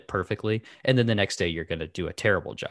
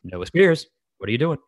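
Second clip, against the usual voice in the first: stern, raised, clearly projected call; louder, higher, controlled reprimand; teacher voice; 6 semitones higher, 6 dB louder than usual. Shown as a demonstration. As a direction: call the child out firmly without shouting. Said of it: "they call it teacher voice".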